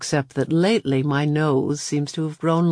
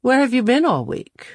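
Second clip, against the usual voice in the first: put-on girl voice; 9 semitones higher, 3 dB louder than usual. A narrator's reading. Claudia voice, pitch up.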